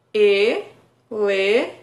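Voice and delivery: "elefante" split into e-le-fan-te, very slow, rising at the end; syllable by syllable. Shown as a demonstration. Therapist counting syllables aloud for a child, very slow. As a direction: tap out each syllable of "elefante". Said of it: "também quatro vezes".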